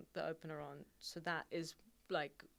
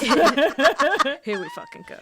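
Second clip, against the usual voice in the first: mock dread; 9 semitones higher, 18 dB louder, much slower than usual. Real, not acted. Mock dread at family questions.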